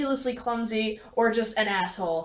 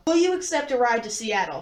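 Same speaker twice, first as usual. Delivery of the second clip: ordinary talk, nothing marked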